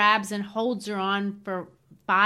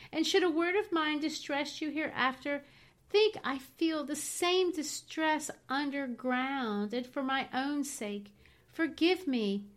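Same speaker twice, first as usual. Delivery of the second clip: higher, gentle pleading; more kindly; 6 semitones higher than usual. Acted. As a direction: soften and implore him tenderly. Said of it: "she continued more kindly".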